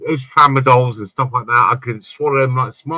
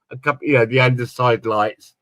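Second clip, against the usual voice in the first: ordinary talk, nothing marked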